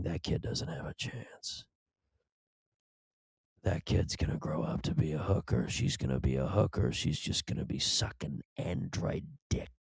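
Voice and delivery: hushed, gossiping aunt voice; judgy aunt. Retold or imitated. Comedian imitating his aunt's hushed gossip about kids. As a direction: whisper the verdict like a scandalized aunt. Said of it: "she'd be sitting there going".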